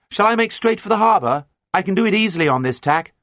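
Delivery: high, loud call from boat to boat; calling across water. A narrator's reading. Mate hails her captain across the water, calling out. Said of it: "Mate Susan called across the water".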